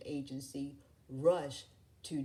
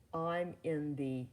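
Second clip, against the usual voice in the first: bragging; 4 dB louder than usual. A narrator's reading. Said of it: "Randall bragged".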